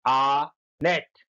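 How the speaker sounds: Honest drawn out slowly as आ and nest; slow syllable split. Shown as a demonstration. Teacher splitting the word for pupils, slowly. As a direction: stretch honest into its two parts. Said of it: "दो शब्द, आ और nest हो गया".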